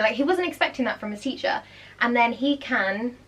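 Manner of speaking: normal talking voice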